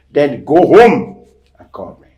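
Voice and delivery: irritated pastor. Retold or imitated. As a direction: snap it back like an annoyed pastor. Pastor scolds the man.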